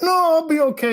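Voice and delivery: hammed-up hiker brushing off a hernia; imitating Wolfman. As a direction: pitch it up and wave off the worry. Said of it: "And we're like".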